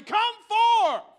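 high, booming drawn-out call; commanding shout. Acted. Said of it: "I'm gonna say".

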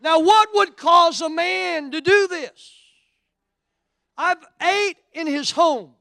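high, loud, slow preacher's climax; full-force sermon peak, loud and drawn out; preaching at full force. Acted. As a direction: drive each phrase home slowly to the crowd.

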